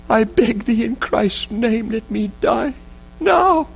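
anguished pleading to God, breaking into a cry; retold sobbing prayer. Father begging God to let him die, sobbing. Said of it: "he sobbed again".

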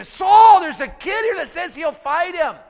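loud, high call on the name, easing after; excited calling out. Acted. Messengers to King Saul with news, excited shouting.

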